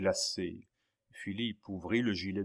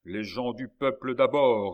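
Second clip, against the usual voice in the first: loud, raised proclamation; curt authority; 4 semitones higher, 8 dB louder than usual. A narrator's reading. Surgeon turning away newcomers by his maxim, calling out. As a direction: call out the rule over a crowd.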